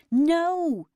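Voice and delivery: high, loud wailing cry. A narrator's reading. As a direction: wail it in despair. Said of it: "he wailed".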